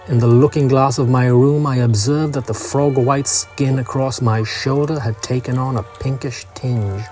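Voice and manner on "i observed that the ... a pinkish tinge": plain reading voice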